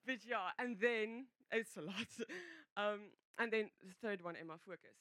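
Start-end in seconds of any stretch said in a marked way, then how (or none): 2.1-2.7 quick, high, soft laugh; short laugh. Real, not acted. Speaker laughs at how much there is, short laugh. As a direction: let out a small laugh. Laughs while collecting her thoughts.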